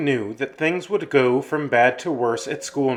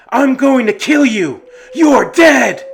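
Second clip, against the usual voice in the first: very loud, high-pitched threatening scream; enraged scream; 11 semitones higher, 10 dB louder than usual. Acted. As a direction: scream a death threat in drunken rage. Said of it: "ran towards them with a scythe in his hands and screamed".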